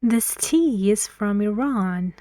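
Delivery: "tea" and "Iran" stressed, other words light. Shown as a demonstration. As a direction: lean on "tea" and the end of "Iran". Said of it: "the words are tea and Iran".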